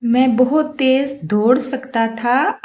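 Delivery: usual speaking voice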